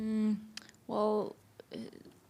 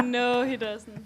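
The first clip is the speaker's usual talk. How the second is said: ordinary talk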